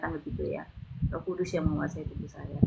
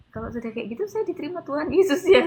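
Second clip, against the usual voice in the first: high, louder, eager question; excited retold question; 9 semitones higher, 7 dB louder than usual. New believer asks the prayer helper, excited retold question. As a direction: ask it high and excitedly, retelling.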